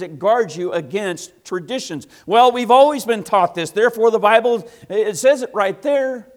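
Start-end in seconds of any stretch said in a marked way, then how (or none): 2.3-6.3 higher, much louder insistence on tradition; loud stubborn believer. Retold or imitated. Preacher voicing a believer who trusts tradition over Scripture, loud and stubborn. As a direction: declare it like it's settled.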